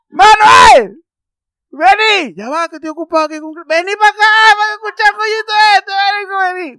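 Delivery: loud, high shout across the house; calling out loudly. Retold or imitated. Yelling to friend Manuel to come hear this, loud call. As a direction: yell for Manuel to come right now.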